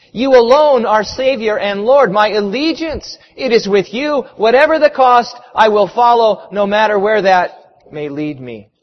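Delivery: forceful preacher's vow; fervent pledge. Acted. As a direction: declare it with conviction, easing at the end.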